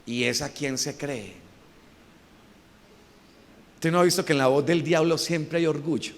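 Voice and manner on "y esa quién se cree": voice of the devil. Acted. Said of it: "La voz del diablo te dice".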